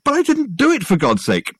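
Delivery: high, strained outburst; Richard, exasperated, insists he's innocent. A narrator's reading. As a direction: burst out protesting.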